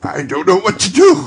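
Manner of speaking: high, loud, tearful laughing cry; half sob, half laugh; overwhelmed joyful wail. Acted. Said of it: "laughing and crying in the same breath".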